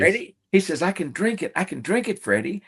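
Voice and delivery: imitating an elderly father's delight at a drink; excited dad. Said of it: "my dad got so excited".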